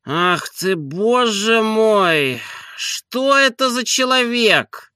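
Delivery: very high, slow whining master; put-upon sleepy complaint; exasperated lament. A narrator's reading. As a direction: moan in long drawn-out vowels. Master laments being woken.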